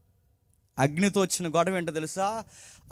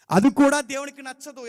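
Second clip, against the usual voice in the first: higher and louder second time; preacher pressing the point hard; emphatic repeat; 6 semitones higher, 5 dB louder than usual. Shown as a demonstration. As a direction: drive the line home with force.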